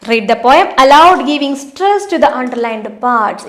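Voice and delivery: reading the question out very slowly; slow, deliberate classroom read-aloud; slow textbook reading. Acted. Teacher reads activity instructions.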